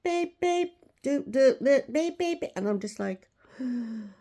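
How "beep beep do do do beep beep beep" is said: high, insistent mock machine beeps; imitated beeping. Imitating the noise by the counter, high beeping. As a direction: mimic the kitchen alarms in a high voice. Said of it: "then I could hear this beep".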